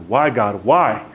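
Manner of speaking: acted, forceful outburst of questioning; asking out loud. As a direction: cry out to God in frustration. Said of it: "began to ask out loud".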